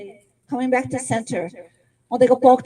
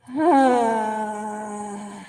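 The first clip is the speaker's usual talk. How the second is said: dramatic sigh. Shown as a demonstration. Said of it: "吸氣, inhale".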